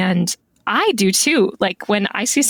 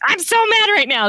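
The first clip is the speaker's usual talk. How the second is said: high mock-angry complaint; mad; 13 semitones higher than usual. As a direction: fume in a high voice. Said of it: "I'm just like".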